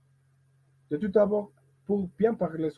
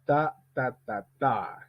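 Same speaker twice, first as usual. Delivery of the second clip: slow "Ta ta ta ta" with the last beat long; final-beat stress. Shown as a demonstration. Teacher tapping out a word's rhythm, last "ta" stressed. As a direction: drill "ta, ta ta TA". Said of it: "Entraînement avec un mot isolé".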